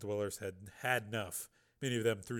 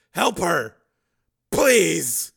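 loud, higher, desperate begging; shouted plea; 6 semitones higher, 14 dB louder, much slower than usual. Acted. Father begs woman to help daughter, shouted plea. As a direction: beg at a shout.

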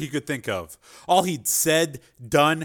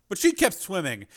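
ordinary talk, nothing marked

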